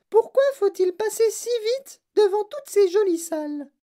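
put-on very high, loud little girl's voice; Veruca Salt demanding. A narrator's reading. Veruca complaining about rushing past rooms, demanding. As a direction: complain in a piercingly high child's voice. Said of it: "demanda Veruca Salt".

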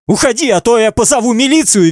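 very high squealed threat; shrieking. A narrator's reading. Girl threatens the intruder with police, shrieking. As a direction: squeal it out in a high strained pitch. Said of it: "визжит Светка".

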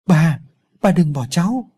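put-on a child's tearful call, narrated with some restraint; crying out loud. A narrator's reading. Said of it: "gào khóc và gọi lớn". (gào gọi bà, van nài)

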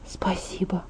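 very soft whispered thanks. A narrator's reading. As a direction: whisper it, barely audible. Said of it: "прошептала я".

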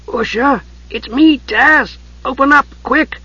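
high, squeezed urgent call. A narrator's reading. Tas asking Usha to open up, squeezed through the keyhole. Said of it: "The voice had a squeezed quality".

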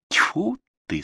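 high exasperated "tfu"; spitting in disgust. A narrator's reading. Manager reacts to Viktor's stalling, spitting in disgust. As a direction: spit it out, fed up. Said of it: "сплюнул управляющий".

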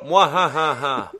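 slow, high, loud evil laugh; evil villain cackle. Retold or imitated. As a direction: cackle like a cartoon baddie.

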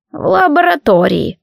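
put-on lightly creaky old man voice; a hint of a creaky old shopkeeper. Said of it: "проскрипел старик".

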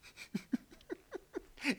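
breathy high-pitched giggle. Acted. Husband giggling as he tells his wife a funny story. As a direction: giggle at the joke to come.

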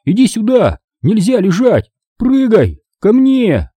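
high, urgent commands; yelling. A narrator's reading. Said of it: "завопил я". (отчаянно командует собаке)

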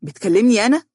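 high, indignant challenge; worked up, rising sharply; agitated. A narrator's reading. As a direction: throw back an offended question. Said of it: "وقال عمرو في انفعال".

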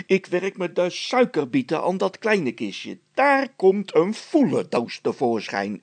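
indignant, loud and high spluttering; sputtering. A narrator's reading. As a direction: rant in exasperation over the boxes. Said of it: "En hij begon meteen te sputteren". (verontwaardigd sputteren)

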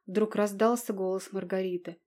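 plain reading voice

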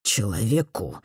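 low, quiet, menacing boss; a quietly threatening old don; mob boss. A narrator's reading. The old don's threatening answer. (тихо и зловеще, как глава мафии)

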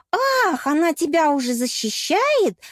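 high gasp of outrage, then accusing; торжествующий рёв. A narrator's reading. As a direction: roar it in jealous triumph. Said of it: "торжествующе взревела Эль". (торжествующе и ревниво)

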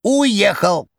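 high, rattling jester voice. A narrator's reading. Koroviev on phone.

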